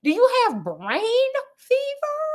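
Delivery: high, fussy Victorian doctor; prim doctor voice. Retold or imitated. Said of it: "Doctor Master P like".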